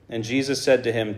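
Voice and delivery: ordinary delivery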